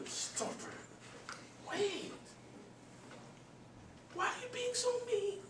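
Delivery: a lightly voiced hurt wife; pleading wife. Wife protesting that the father is being mean, high and soft. Wife torn about crying boy.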